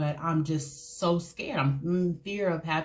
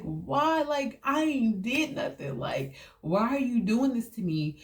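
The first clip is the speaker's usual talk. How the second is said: higher-pitched, bewildered protest; retold pleading; 6 semitones higher than usual. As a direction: act out a child's plea, voice raised in pitch. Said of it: "you'll be like".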